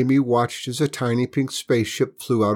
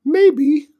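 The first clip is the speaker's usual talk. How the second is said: very high, loud squeaky alien; tiny alien voice; 8 dB louder than usual. Acted.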